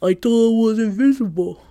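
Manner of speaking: lightly put-on dopey teen voice; brother's voice. Sister repeating her brother's line in a light imitation. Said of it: "That's how he sounds".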